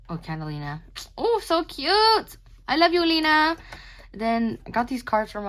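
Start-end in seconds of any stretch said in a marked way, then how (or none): 1.2-3.6 gushing adoration. Real, not acted. Artist cooing over her drawing of Catalina, loud and high. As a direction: gush over it like a beloved pet.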